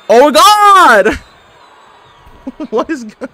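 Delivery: alarmed cry. Real, not acted. Gamer watching his wrestler get beaten, loud alarmed cry. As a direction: blurt it out in alarm. Reacting to match going wrong.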